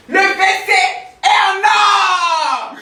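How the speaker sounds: high, loud, very slow shout; amazed shout. Real, not acted. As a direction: drag out the shout in wonder. Shows off the golden toilet.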